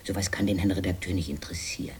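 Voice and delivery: fast whisper to hush him. Acted. Wife hushing her husband in front of the reporter, fast whisper. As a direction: hiss it quickly under the breath. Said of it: "Nicht doch, Hans".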